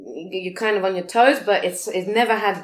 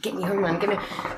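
quietly repeated demand; muted insistence; 7 dB quieter than usual. Retold or imitated. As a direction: say it under the voice, fed up. Retold plea while pushing hand away.

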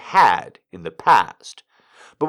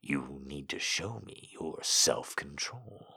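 low, much softer, controlled; quiet demand; 10 semitones lower, 18 dB quieter than usual. A narrator's reading. Don tests his pet's self-control, low quiet demand. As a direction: demand restraint in a low hush.

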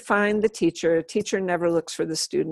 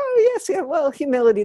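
high giggly reply, much higher and louder; giggling; 7 semitones higher, 4 dB louder than usual. Retold or imitated. Monk answering her humility question, giggling high. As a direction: imitate the monk tittering through his answer. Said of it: "And he started giggling".

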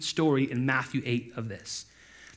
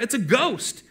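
raised-pitch storybook cry of fright; mild fearful cry; 8 semitones higher, 4 dB louder, slower than usual. A narrator's reading. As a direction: read it as a frightened outcry. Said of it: "they cried out".

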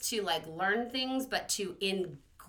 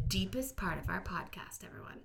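dramatic whisper; 2 semitones lower, 9 dB quieter than usual. Acted. Mock dramatic podcast segue.

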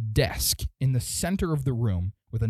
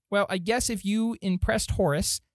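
higher-pitched gnome official; second gnome; 7 semitones higher than usual. Acted. Gnome official conceding to Horace's recruits, higher voice. Gnome line redone without y'all.

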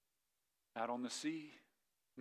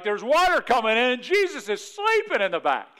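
loud, high-pitched alarm; hammed-up panic, pushed high and loud; panicked disciple; 7 semitones higher, 9 dB louder than usual. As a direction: cry out over the storm in disbelief. Acts out disciples' panic in storm.

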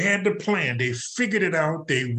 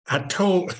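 ordinary talk

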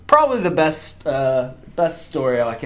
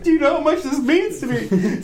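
high-pitched, exaggerated plea; mock pleading; 11 semitones higher than usual. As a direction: plead with the guard in a comic high voice. Pleading with guard in retold scene.

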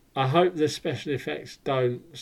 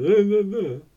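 mock protest; 9 semitones higher, 8 dB louder than usual. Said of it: "for anyone who's going".

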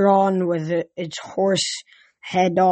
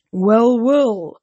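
god's big booming voice; 3 semitones higher, 5 dB louder than usual. A narrator's reading. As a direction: boom it from under the car. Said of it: "a deep voice boomed".

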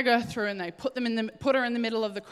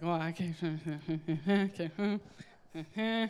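low, awkward chuckling retreat; soft, mock-embarrassed backing down; sheepish old leader; 5 semitones lower, 6 dB quieter than usual.

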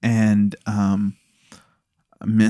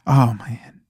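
savoring whisper; 3 semitones lower than usual. Real, not acted. Savoring remembered food.

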